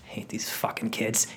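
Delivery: whispered grumble; old actor whispering on stage about the kids, whispered. Retold or imitated. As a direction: hiss it under your breath like a bitter old actor. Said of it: "kinda whispering".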